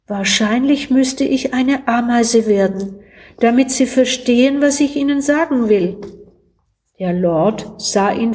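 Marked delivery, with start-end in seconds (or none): none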